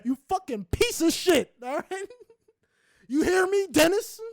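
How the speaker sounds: high, loud, hammed-up shouting; mock furious yell. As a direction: scream it at the imagined slowpoke. Imagined rant at slow stranger.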